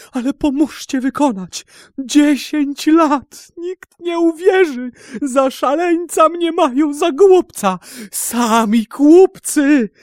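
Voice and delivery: very high, fervent plea; impassioned crying out. A narrator's reading. Captive begging the sultan for backing, fervent high cry. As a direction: plead at the top of your pitch, loud and desperate. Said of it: "zawołał".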